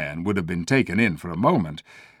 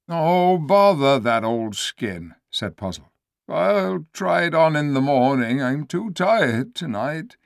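higher, put-upon donkey voice; grumbly donkey voice; 7 semitones higher than usual. Acted. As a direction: play a tired donkey brushing it off.

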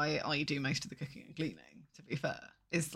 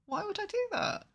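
high indignant; 12 semitones higher than usual. Real, not acted.

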